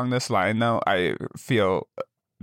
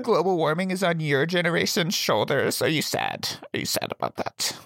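taunting, fading to a whisper; mock pity that dies into a whisper; mocking teen; 7 semitones higher than usual. Teen voice taunting millennials, ending whispered.

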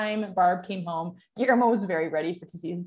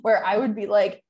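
ordinary talk, nothing marked

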